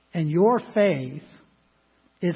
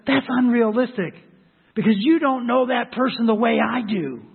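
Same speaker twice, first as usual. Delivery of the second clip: higher, indignant protest; objecting worldly voice; 5 semitones higher, 4 dB louder than usual. Retold or imitated. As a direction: push back like a hurt skeptic. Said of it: "The world says".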